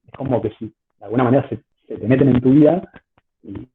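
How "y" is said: ordinary talk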